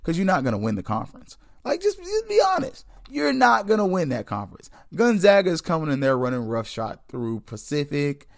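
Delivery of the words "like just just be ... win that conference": mock high voice. Mocking high voice to rival schools.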